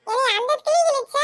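ordinary delivery

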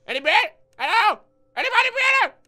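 loud, high-pitched call into the dark; nervous calling out. Real, not acted. Player checks for breathing, calling out nervously.

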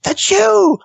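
very high, drawn-out mock delight; delighted recognition. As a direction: stretch the oh like a thrilled star. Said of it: "It's like".

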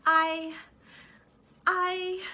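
a frightened mouse stumbling on her words; stammering. Acted. Mouse Mona stammering at the hotel clerk, high and halting. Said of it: "stammered Mona".

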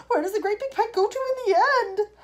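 high, loud, frantic question; shrieking. A narrator's reading. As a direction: shriek it out in alarm. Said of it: "shrieked Missus Salt".

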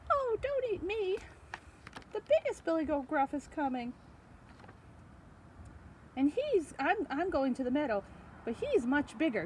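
high, pleading goat voice; pleading middle goat. Acted.

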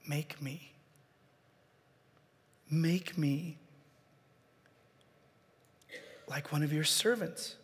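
very soft, humble begging; barely above a breath, humbled; humble quiet plea. Acted. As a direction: plead softly like a broken son. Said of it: "to a heart that said".

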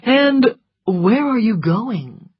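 measured, courteous enquiry; polite question. A narrator's reading. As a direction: ask with drawn-out courtesy. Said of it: "he asked politely".

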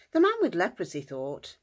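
usual speaking voice

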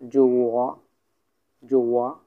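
জুয়া drawn out with a rise at the end; pronunciation demo. Shown as a demonstration. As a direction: model জুয়া slowly for learners. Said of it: "উচ্চারণটা কেমন করে দিব".

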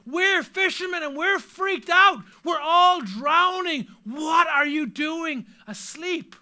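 panicked shouting. Retold or imitated. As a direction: yell it with rising alarm. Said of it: "Another translation might be".

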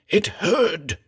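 raving. Acted. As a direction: repeat it, fading a little. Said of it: "raved Callum".

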